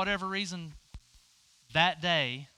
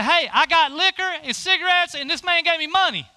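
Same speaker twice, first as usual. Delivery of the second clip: boisterous shout, much higher and louder; mock-hollering a boastful peace offering across the yard; excited call out; 14 semitones higher, 16 dB louder than usual. Derek hollering to his girlfriend on the porch, a loud boastful call.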